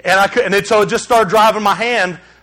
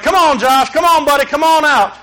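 put-on higher, coaxing call to a hiding child; 6 semitones higher, 3 dB louder than usual. Father calling his hiding son out at the playground, coaxing. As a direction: call him out gently but raised.

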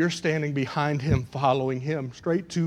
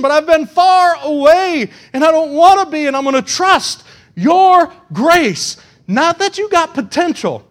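high, loud pulpit plea; impassioned confession; 11 semitones higher, 11 dB louder than usual. Acted. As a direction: cry it out to God. Prayer built to full force.

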